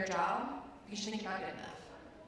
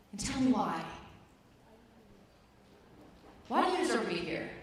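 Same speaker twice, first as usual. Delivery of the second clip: pressing demand; 2 semitones higher, 4 dB louder than usual. Retold or imitated. Trainer pressing hard.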